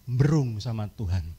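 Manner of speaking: ordinary talk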